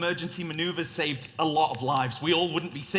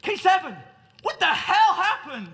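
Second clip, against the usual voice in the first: acted shout; 14 semitones higher, 7 dB louder than usual. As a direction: demand answers at full volume. Captain yelling at the droid.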